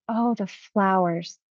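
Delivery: slow, wondering oh, the flowers. Retold or imitated. Client seeing flowers on the other side, slow wonder. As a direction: linger on the flowers in awe. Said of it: "And then he said".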